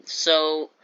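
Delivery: ordinary talk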